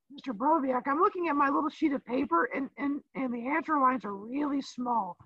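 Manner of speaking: high, rising whiny student protest; teacher mimicking Sydney pleading innocence; mock student voice. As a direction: play the kid claiming innocence.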